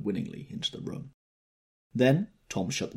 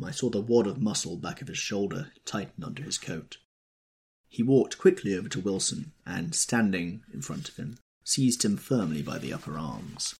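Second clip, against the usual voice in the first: usual speaking voice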